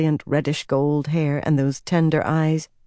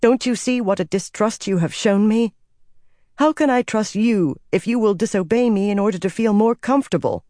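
higher, exasperated questioning; frustrated scolding in a raised pitch; 6 semitones higher than usual. A narrator's reading. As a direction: reproach him, patience worn thin.

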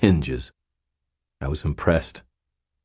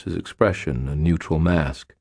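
plain reading voice

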